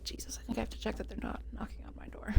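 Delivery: tense, fast whisper. Real, not acted. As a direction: whisper it quickly, nervous. Nervous about knocking at door.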